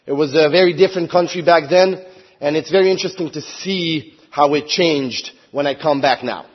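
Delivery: normal talking voice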